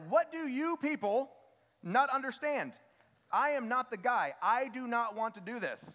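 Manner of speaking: high, loud, emphatic retold protest; exasperated refusal, driven hard word by word; fed-up outburst. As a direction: act out losing patience with everyone. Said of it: "I finally had enough".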